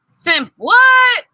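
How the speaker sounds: high, loud baffled question; puzzled boy mangles the word; confused. A narrator's reading. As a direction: shout out the strange word in confusion. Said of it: "He sounds confused".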